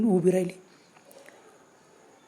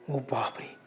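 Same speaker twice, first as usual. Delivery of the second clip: low, hushed gasp of fright; a breathless "ओ बापरे" as the face turns; fearful gasp; 7 semitones lower, 10 dB quieter, much slower than usual. A narrator's reading. Storyteller reaching the ghost's face, hushed fearful gasp. Narrator gasps in fear.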